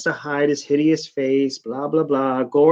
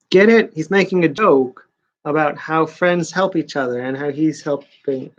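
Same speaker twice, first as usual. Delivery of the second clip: normal talking voice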